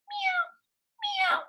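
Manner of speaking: high thin cat meow; tiny kitten meows. A narrator's reading. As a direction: mew like a small kitten.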